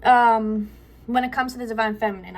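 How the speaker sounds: breathing hard after the stairs; winded, short of air. Real, not acted. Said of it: "I'm out of breath".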